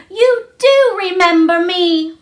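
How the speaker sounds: delighted cry. A narrator's reading. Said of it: "she cried out".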